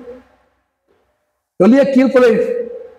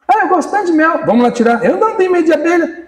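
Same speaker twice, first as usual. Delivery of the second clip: mock eager freeloader; 8 semitones higher than usual. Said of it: "esse tipo de gente".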